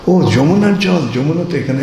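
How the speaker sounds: a mother puzzled at the strange cure; puzzled surprise. Acted. Yashoda puzzled by the remedy.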